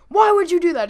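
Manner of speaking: high, loud confrontation; imitated angry yell. Homeowner confronts Glenn over the dunk, imitated angry yell.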